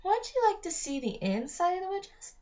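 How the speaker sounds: high, crackly witch voice. A narrator's reading. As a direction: coax the children inside, witchlike. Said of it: "Her voice was high-pitched and crackly".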